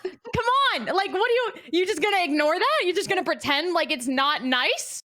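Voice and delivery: high, loud incredulous questions; exasperated protest. Real, not acted. Challenging friends who won't admit it, exasperated. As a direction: push the point, pitch jumping up.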